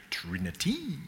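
trini-TEE, high and soft with the end held; stress on last syllable. Shown as a demonstration. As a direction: push Trinity up high onto the final TEE. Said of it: "Which syllable do you emphasize?".

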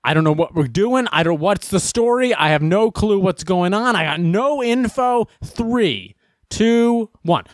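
frantic clueless anchor, high; flustered anchor. Retold or imitated. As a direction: panic like an unprepared anchor. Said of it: "the anchor is like".

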